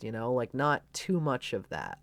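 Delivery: usual speaking voice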